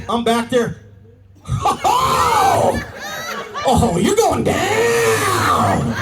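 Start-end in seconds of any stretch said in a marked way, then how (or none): 1.5-5.9 high, drawn-out whoop stretching into the threat; gleeful pounce. Acted. Officer spotting an illegal U-turn, gleeful drawn-out shout.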